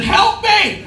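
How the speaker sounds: very high acted cry of panic; screaming for help. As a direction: scream it out to people out of reach. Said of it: "I'm screaming at them".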